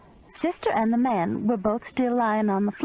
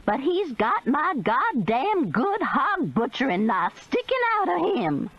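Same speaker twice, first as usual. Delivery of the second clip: outraged; 6 semitones higher than usual. A narrator's reading. Outraged old woman.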